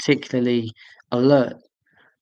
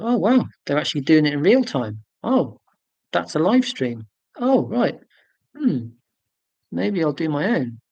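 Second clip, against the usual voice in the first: acted dawning surprise; 3 semitones higher than usual. Streamer retelling how they discovered livestreams, in dawning surprise. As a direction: play up the penny dropping.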